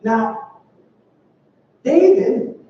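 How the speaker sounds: ordinary talk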